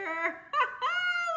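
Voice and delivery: high, loud mocking laugh; a pack of dogs laughing at a friend; dogs howling laughter. A narrator's reading. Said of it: "they howled with laughter".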